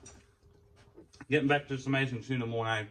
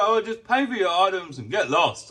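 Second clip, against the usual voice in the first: dismissive attendant; 5 semitones higher, 8 dB louder than usual. Retold or imitated. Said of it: "He's like".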